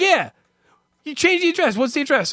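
high, chirpy mock shipping agent; eager agent voice. Comedian mimics the shipping agent in a high chipper voice. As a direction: play the agent as overly eager.

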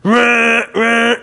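loud buzzing "ruh, ruh" like a vibrating phone; short loud mock vibration buzz; imitated phone buzz. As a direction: mimic the rattle of a phone on vibrate. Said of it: "I fucking feel it".

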